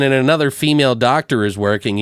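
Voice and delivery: usual speaking voice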